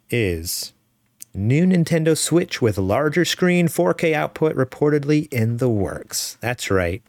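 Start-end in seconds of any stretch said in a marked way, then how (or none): 1.3-6.4 slow, higher-pitched announcer delivery; news headline read. Acted. Host opens the news segment, announcer-style headline read. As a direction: proclaim the headline, stretching it out.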